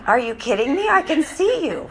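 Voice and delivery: incredulous mock protest, pitch leaping up at the end; exaggerated disbelief, drawing out the last phrase; playfully indignant, rising high. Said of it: "I'd be like".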